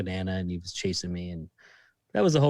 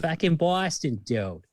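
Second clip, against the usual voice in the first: higher, slow, emphatic Boston accent; 4 semitones higher, slower than usual. Shown as a demonstration. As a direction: lean harder into the Boston accent. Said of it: "the Boston accent right".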